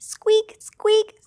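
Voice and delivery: high little squeaks; mouse squeak. Acted. A teacher voicing Mouse for children, high squeaky call. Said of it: "said Mouse".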